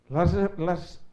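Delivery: usual speaking voice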